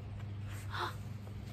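sudden delighted intake of breath; quick airy gasp of wonder; sharp breathless gasp. Acted. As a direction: gasp of surprise. Surprise before it worked.

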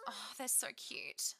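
whispered, slowed coo; hushed aww; soft coo. Real, not acted. Cooing over cute sims.

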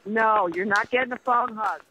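usual speaking voice

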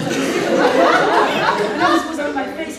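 loud laugh through words. Real, not acted. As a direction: laugh out the line. Laughing while telling.

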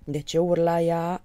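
slow, baffled question; puzzled exclamation. A narrator's reading. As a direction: wonder aloud slowly. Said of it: "exclamă Moromete nedumerit". (exclamație nedumerită)

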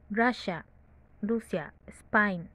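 ordinary talk

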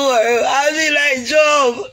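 slow, high, crying declaration; tearful shout. Real, not acted. To her viewers vowing to endure like Job, crying shout. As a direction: cry out the vow through tears.

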